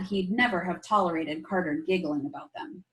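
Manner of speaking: usual speaking voice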